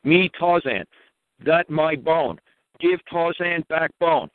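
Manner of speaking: mock Tarzan grunting out simple words; caveman Tarzan talk. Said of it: "do it in one syllable".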